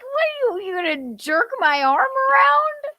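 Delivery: high, loud mock outrage; mock pissed off. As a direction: act out an indignant squawk at being yanked. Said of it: "He was pissed off".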